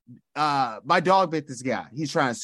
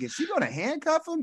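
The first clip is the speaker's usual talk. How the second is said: mock alarmed; 10 semitones higher, 5 dB quieter than usual. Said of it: "I was like".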